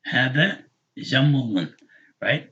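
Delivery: ordinary delivery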